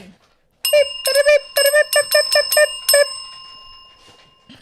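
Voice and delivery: very high, loud squeaky beeps; silly beeping. Pretend. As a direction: chirp out a string of cartoon beeps. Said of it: "do your fishy, fishy".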